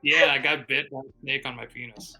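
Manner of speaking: usual speaking voice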